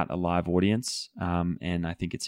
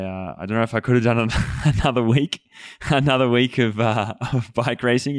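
laughing through the words, a bit higher and louder; chuckling at the thought of more racing; 4 semitones higher, 4 dB louder than usual. Real, not acted. Former rider laughs about not managing another week of racing.

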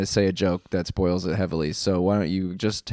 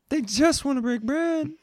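much higher mocking echo; high squeaky echo; 3 dB quieter than usual. Echoing in a squeaky voice.

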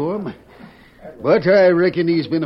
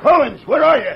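calling out; 9 semitones higher, 6 dB louder than usual. Acted. Marshal calls into dark office.

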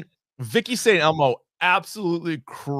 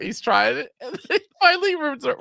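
ordinary talk, nothing marked

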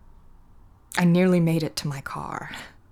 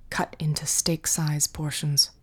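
usual speaking voice

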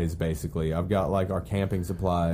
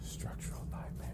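hushed echo; 17 dB quieter than usual. Pretend. Echoing the co-host's phrase as a band name, whispered. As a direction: whisper it under the other speaker's words.

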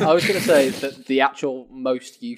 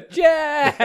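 calling out; 13 semitones higher than usual. Retold or imitated. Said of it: "get everybody in the pub to go".

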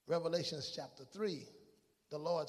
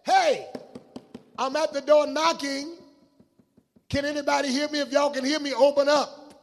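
loud, high shout to people inside; raised-voice appeal from outside a door; calling through the door; 11 semitones higher, 16 dB louder than usual. Acted. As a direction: call out urgently to be let in. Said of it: "he's saying to them".

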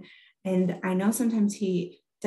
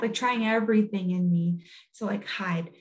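ordinary talk, nothing marked

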